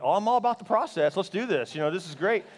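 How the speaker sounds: loud, high, comic enthusiasm; eager agreement. Retold or imitated. Husband telling wife he's all for a baby, loud and eager. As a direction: jump on the idea with playful gusto.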